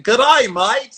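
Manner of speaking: high, loud mock Australian greeting; cheery Aussie "Good day, mates" thrown up high; Australian accent. Host imitating Australians, bright Australian accent. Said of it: "they have that wonderful accent".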